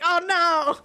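high-pitched retold dismay. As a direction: cringe at your own blunder. Retold dismay at own blunder.

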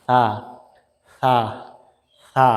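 সা with the tongue tip on the upper teeth and a light breath, like English th in thin; Arabic tha sound in সা, soft and airy. Shown as a demonstration. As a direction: breathe out gently through সা, tongue touching the teeth. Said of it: "বাতাস বের হবে".

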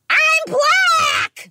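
shrieked high and loud, full mockery; sudden high mocking shriek; mock shout. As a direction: yell it like a ridiculous claim. Mocking claim from a fake persona.

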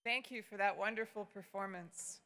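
usual speaking voice

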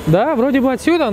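ordinary delivery

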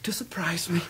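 hushed confiding whisper. Acted. Husband whispering fondly to his old friend about his wife. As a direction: whisper it so she won't overhear.

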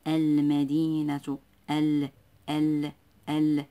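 slow المدينة, then ال alone with a held L; lam pronounced. Shown as a demonstration. Teacher pointing out the lam, ال drawn out clearly. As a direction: isolate ال, sounding its L firmly. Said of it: "ننطقها ونقرأها".

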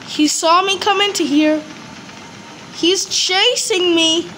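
ordinary delivery

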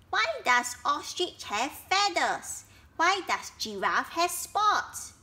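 put-on wide-eyed child full of questions; curious baby elephant. Baby elephant always asking questions, curious.

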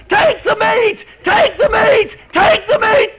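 high, loud repeated cry; shouted refrain. Acted. Preacher crying the eagles' call to the congregation, full shout.

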